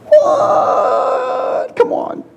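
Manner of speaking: shocked high yelp of disbelief; incredulous high cry. Acted. Preacher mocking the hearers' refusal, a high shocked cry. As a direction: shriek it, unvoiced and high.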